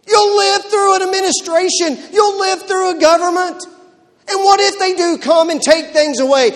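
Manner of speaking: loud, high-pitched shouting sermon; fiery preaching. Acted. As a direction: preach it hard, full force at the congregation. Preaching at full force.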